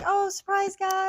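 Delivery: high, bright mock announcement; excited surprise. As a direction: play up a cheerful surprise reveal.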